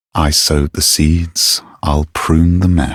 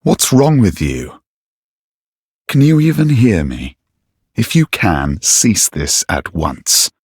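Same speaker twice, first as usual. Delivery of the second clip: higher-pitched angry questioning; exasperated demand; 4 semitones higher than usual. Acted. Knight confronting an attacker who won't answer, exasperated.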